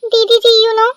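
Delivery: ordinary talk, nothing marked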